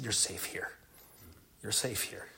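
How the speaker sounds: soft, low retold reassurance; gentle reassurance. Husband retells comforting his wife at night, soft reassurance. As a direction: quietly tell her she is safe.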